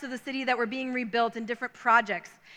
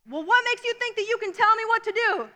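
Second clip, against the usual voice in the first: yelled; 8 semitones higher than usual. Retold or imitated. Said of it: "he yelled at him".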